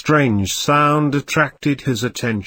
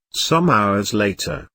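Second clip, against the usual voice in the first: ordinary delivery, nothing marked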